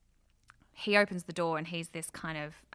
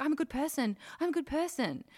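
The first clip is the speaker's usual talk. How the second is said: higher pitched, mock-frightened pleading; freaked-out pleading; 8 semitones higher, faster than usual. Man caught out by her pleads his goodness, higher mock-frightened. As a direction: squeak it out like a scared guy begging. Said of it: "he's freaked out".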